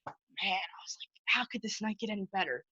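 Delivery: soft, half-whispered wonder; hushed awe. Retold or imitated. Fan marvels to himself about his night, hushed. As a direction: drop it to an awed undertone. Awed aside after meeting idol.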